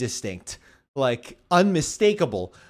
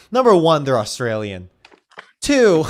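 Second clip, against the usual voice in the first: mock list-making; 7 dB louder than usual. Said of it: "thought you were just gonna stop after Australian".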